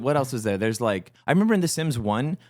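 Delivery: usual speaking voice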